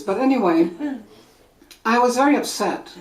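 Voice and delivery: normal talking voice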